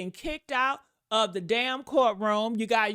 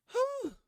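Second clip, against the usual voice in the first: high-pitched, softly blown whew; high exhaled whew; 13 semitones higher, 5 dB quieter than usual. Real, not acted. Reacting to photos of bruises, high exhaled whew. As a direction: let out a high, airy whew.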